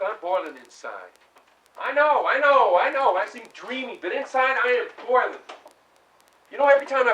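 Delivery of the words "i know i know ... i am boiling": insistent. Acted. Tom insists he is boiling.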